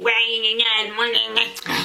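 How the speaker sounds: sing-song whiny mockery; mocking gna gna. Mocking the commenters who will insult her, whiny gna gna. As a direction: mimic the haters like a nagging kid.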